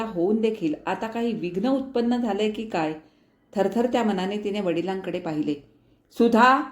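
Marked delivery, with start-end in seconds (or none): 6.1-6.6 sharp, loud, high angry call of a name; fierce call. A narrator's reading. Angry father calls his daughter sharply. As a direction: summon her harshly. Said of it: "वडिलांच्या हाकेत विलक्षण उग्रता होती".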